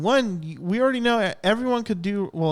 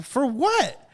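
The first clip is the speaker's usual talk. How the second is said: high, incredulous for what; baffled retort; 7 semitones higher than usual. Retold or imitated. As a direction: throw it out in disbelief. Baffled at firing policy.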